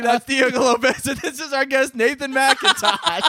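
host opening the show late, laughing announcer voice; mock host intro. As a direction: welcome listeners, hammed up.

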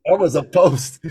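giggling echo of the punchline; laughing. Real, not acted.

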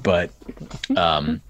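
ordinary delivery

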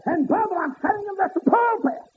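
high ringing preacher's cry; preaching at full force. Acted. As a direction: lift it up and ring it out to the congregation. Preacher whooping.

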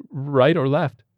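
high, tense patient's question; anxious patient. Acted. Patient asks tiger surgeon which hand to give, anxious.

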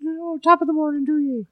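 high, hammy fake Irish accent. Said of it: "that friggin' annoying fake Irish accent".

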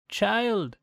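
put-on high old crone voice; fawning old hag; old woman voice. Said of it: "said the old woman".

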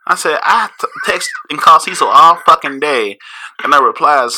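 high, loud, fired-up storytelling; worked-up retelling. Retold or imitated. Retelling to his cohost a frantic day of worry, high and loud.

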